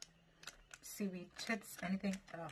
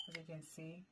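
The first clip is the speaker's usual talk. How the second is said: whispering; 10 dB quieter than usual. Real, not acted. Whispered aside to viewers.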